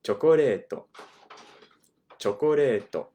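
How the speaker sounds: chocoreto, spoken with Japanese sounds and a tapped r; teacher models Chocoreto for the class. Shown as a demonstration. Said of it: "The word for chocolate".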